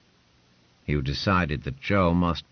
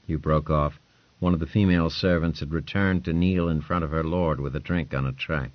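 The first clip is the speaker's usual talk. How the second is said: plain reading voice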